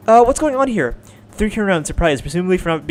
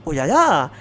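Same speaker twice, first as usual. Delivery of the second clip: ordinary delivery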